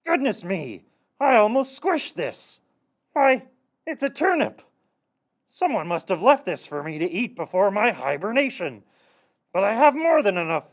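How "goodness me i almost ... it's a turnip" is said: high-pitched astonished discovery; surprised bear. Acted. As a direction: rise higher with surprise. Bear finds turnip at door.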